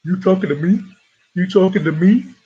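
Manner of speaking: lightly voiced movie tough-guy challenge; film actor impression. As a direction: put the line as a pointed, rising challenge. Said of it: "That's my best impersonation of that actor".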